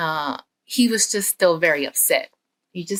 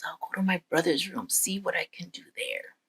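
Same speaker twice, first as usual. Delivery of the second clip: soft, hurried whisper to himself; sneaky whisper; 8 dB quieter, faster than usual. Acted. Boy sneaks to brothers' room, scheming whisper. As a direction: plot under your breath while creeping along.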